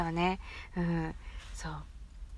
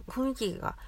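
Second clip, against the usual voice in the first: normal talking voice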